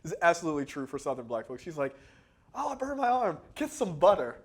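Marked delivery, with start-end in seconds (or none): 2.5-4.4 imitated Big Mama. Voicing Big Mama's folk remedy.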